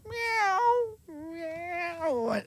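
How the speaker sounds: soft, high, drawn-out miaow; coaxing cat meow. Acted. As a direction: plead like a cat wanting a tickle. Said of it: "He'll roll over and go".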